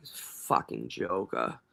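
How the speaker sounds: low, slow contemptuous mutter; scornful aside. Real, not acted. Commentator mocking the witness, low scornful mutter.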